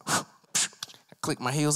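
ordinary delivery

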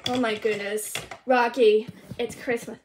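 ordinary talk, nothing marked

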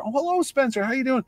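put-on high, sing-song greeting to a small boy; bright high voice for a shy kid; gently coaxing a child; kindly voice to a child. Mark Hamill greeting small child.

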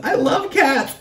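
high giggling cat-lover delight; giggly cat love. Real, not acted. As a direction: squeal it out through a laugh. Gushing about cats, laughing.

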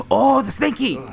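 high surprised cry; startled and high. Real, not acted. Recognizes a creepy toy.

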